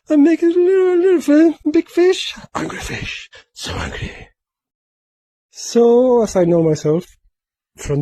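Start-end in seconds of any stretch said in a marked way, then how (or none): 0.1-2.3 squeaky silly voice. Pretend. Squeaky voice about the fish. 2.5-4.3 whisper. Pretend. Whispered fish voice.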